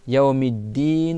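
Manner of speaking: "Yaomiddin" spoken slowly with the double d held; slow careful pronunciation. Shown as a demonstration. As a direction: stretch "Yaomiddin" syllable by syllable. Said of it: "et tu prolonges".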